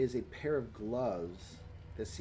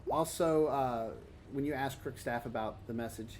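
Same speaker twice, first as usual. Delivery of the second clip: normal talking voice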